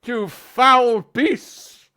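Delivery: put-on high, very slow, loud old man's challenge; an old man in his briefs taking up the fight; old challenger's cry. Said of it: "he goes".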